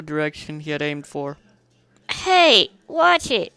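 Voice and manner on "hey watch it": high, loud startled yelp; Spike protesting. A narrator's reading. Spike yelping at the hero who grabbed him. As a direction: squeak it out, caught off guard. Spike tossed onto back.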